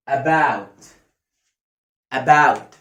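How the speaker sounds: "about" with a long, open ow; open "ow" model. Shown as a demonstration. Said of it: "خد بالك بقي عامل إزاي؟". (نطق "about" بصوت آو مفتوح)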